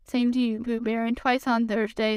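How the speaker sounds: ordinary delivery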